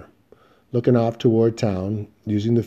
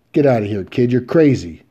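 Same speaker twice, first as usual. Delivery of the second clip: angry shout; 4 semitones higher, 7 dB louder than usual. Acted. Outlaw yelling at accuser.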